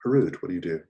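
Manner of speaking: normal talking voice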